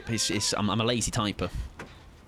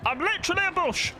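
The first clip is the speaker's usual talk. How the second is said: much higher, squeaky panic; panicked squeak. Real, not acted. Cornered by the killer, squeaking in panic. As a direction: squeal it like you're done for.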